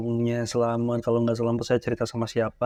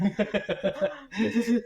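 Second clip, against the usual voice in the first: amused, higher-pitched laugh; 7 semitones higher than usual. Real, not acted. Host reacts to the guest's story, laughing.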